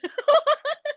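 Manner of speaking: high bursting laugh; burst of laughter. Real, not acted. Laughing while retelling Andrew's remark, high laughter.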